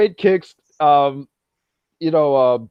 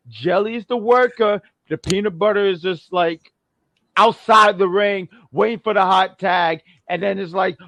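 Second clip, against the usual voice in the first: usual speaking voice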